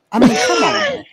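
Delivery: exasperated shout. Real, not acted. Exasperated outburst in debate.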